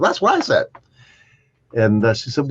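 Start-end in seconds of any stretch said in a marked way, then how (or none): 0.0-0.7 much higher mock exclaim; tossing it back, a lot higher; higher, mock exasperated lady. Patient echoing the doctor, mock higher voice.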